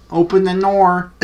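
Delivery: loud, high, officious banging-on-the-door bark; hammed-up hall-monitor yell; mock authority shout. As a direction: play the stern security guard yelling at the stall. Security guard busting friends in bathroom.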